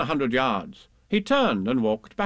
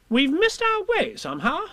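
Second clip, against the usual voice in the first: high fussy admission; fussy Rabbit. Acted. Rabbit tells the others they are lost, high fussy voice. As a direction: admit it grudgingly.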